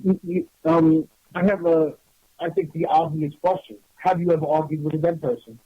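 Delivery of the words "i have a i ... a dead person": ordinary talk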